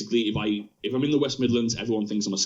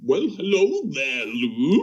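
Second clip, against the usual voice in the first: higher, slow, suave greeting; put-on new co-host voice; 4 semitones higher, 3 dB louder, slower than usual. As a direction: greet him like a smooth stranger taking over the show. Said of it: "let's hear".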